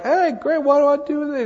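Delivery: high-pitched mock gift recipient; baffled gift opener. Someone opening the wrong Christmas present, high puzzled put-on voice.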